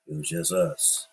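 ordinary talk

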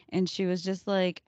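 usual speaking voice

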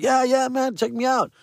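high, smug show-off voice; cocky. Retold or imitated. Playing along with the lie to girls, high and cocky. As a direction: brag like you're already famous. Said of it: "I'm just like".

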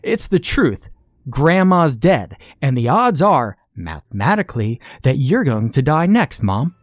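put-on a boy hammering home grim facts to his mother; defiant insistence. Boy insists on death odds.

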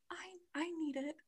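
tiny, soft and small; tiny mouse voice. Retold or imitated. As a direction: squeak it out like a little mouse. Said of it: "the tiniest little mouse voice".

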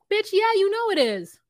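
high, loud sassy comeback; hammed-up "oh please" disbelief; mock exasperated retort. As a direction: call out someone playing dumb. Retorts to the retold feigned surprise.

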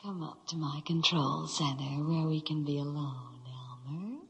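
seductive unvoiced whisper. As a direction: breathe it to your lover, whispering. [name] whispers to Elmer.

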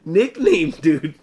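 laughing through the words; amused laugh while speaking. Real, not acted. Laughing at the nickname.

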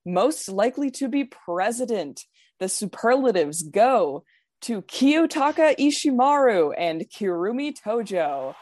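measured, dramatic awards announcer voice. Pretend. Reading out the final superlative results to friends, dramatic announcer voice. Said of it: "dramatic announcer voice?".

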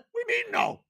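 incredulous high-pitched outburst; shrill disbelief. Retold or imitated. Retold disbelief at wife.

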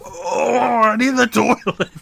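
high, loud, hammed-up toilet emergency; mock urgent groan. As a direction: wail it like a badly faked stomach emergency. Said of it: "and then being like".

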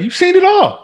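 very high, emphatic comeback; animated retort. Retold or imitated. Answering doubters about long marriages, animated retort. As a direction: send the pitch soaring on the reply. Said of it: "I be like".

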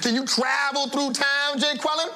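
mocking rant. Teacher mocking the student.

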